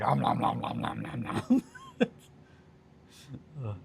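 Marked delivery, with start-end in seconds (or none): 0.0-1.6 breathy nom nom chomping sounds; a child happily gobbling packing peanuts; mock munching. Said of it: "it was just like".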